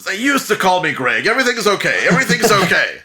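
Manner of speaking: high, loud hurried reassurance; smooth it over nervously; flustered cover-up. Acted. Speaker covering to the guards, high and flustered.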